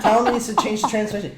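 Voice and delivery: quick, high retold prompt; feeding a line. Coaching a four-year-old on the mic, quick retold prompting. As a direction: prompt a child in a rush. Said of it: "I was just feeding her lines".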